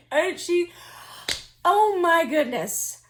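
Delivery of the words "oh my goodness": usual speaking voice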